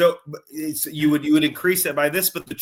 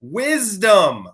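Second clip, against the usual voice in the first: teasing call-out; 4 semitones higher, 6 dB louder than usual. Acted. Teasing friend.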